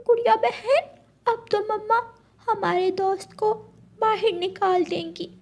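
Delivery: cartoon child pleading with mother, high pitched. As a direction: plead sweetly so the friend can stay.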